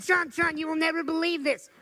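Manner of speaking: high and loud eager rush; excited caller. Retold or imitated. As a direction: sound thrilled with big news. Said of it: "She said".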